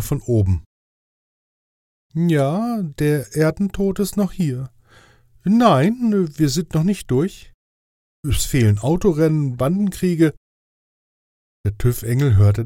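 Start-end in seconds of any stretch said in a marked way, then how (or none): 2.1-10.3 higher, official angel voice; fussy inspector angel. Acted. Inspector angel grading Death's test, higher official voice. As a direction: play the strict inspector angel, pitched up. Radoril answers voice from above.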